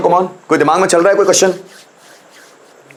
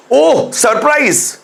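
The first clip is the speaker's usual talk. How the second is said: slow drawn-out surprised oh; mock surprise; 7 semitones higher, much slower than usual. Shown as a demonstration. Teacher acting out a happy surprise, drawn-out oh. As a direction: stretch the oh in delight. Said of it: "खुशी में surprise हो जाए".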